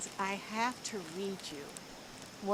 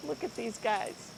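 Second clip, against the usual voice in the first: lively, expressive storybook reading; reading to children; 3 semitones higher, 6 dB louder than usual. Acted. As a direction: read it like a picture book to a class. Teacher reads picture book aloud.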